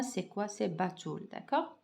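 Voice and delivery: usual speaking voice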